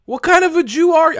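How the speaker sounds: outraged. Real, not acted. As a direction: erupt at him in disbelief. Outraged rant at a panelist.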